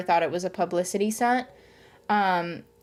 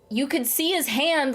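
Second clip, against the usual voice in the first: ordinary talk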